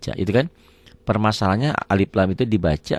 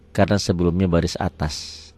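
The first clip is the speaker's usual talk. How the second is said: usual speaking voice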